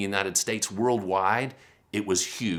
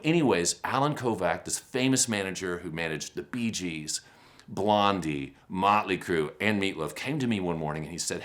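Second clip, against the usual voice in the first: ordinary talk, nothing marked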